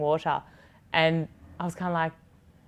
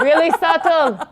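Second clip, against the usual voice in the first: laughing mock quote; 10 semitones higher, 8 dB louder, slower than usual. Mock quote of own flirting.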